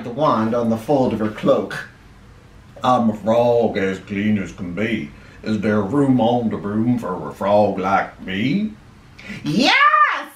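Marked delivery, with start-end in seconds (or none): 2.8-8.7 low-pitched croaky frog voice; deep storybook frog asking to join; croaking frog. A narrator's reading. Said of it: "then said with a croak". 9.4-10.3 high, gleeful witch's cry; sudden high-pitched yes. A narrator's reading. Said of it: "cried the witch".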